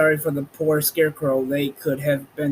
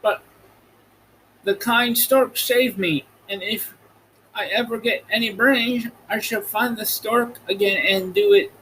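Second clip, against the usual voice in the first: much higher, relieved straw man; a grateful Scarecrow, voice pitched up; Scarecrow's voice; 7 semitones higher than usual. A narrator's reading. Scarecrow telling friends he feared the river, high voice.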